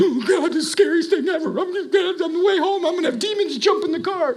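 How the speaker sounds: high, squeaky mock panic. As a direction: pretend to be a scared churchgoer, squealing. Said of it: "'cause you're like".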